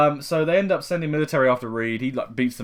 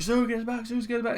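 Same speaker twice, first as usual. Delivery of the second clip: mock panicked plea; 10 semitones higher, 3 dB quieter, faster than usual. Johnny pleading as pod fails.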